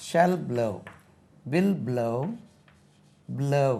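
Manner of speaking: normal talking voice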